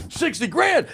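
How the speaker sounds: sixty grand in a high amazed voice; stunned. Retold or imitated. Veteran hearing his salary offer, amazed. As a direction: repeat the number in disbelief.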